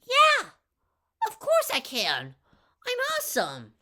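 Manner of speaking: put-on very high, loud, fast kid brag; tiny kid bragging to the crazy kid, very high and fast; little kid voice. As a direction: squeak out a boast like a cocky tiny kid. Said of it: "this little kid's like".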